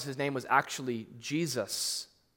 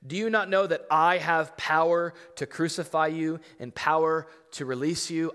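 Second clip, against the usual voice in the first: louder, higher boastful challenge; arrogant Pilate; 4 semitones higher, 7 dB louder than usual. Acted. Pilate boasting of his power to Jesus, loud arrogant challenge. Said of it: "the audacity and the foolishness".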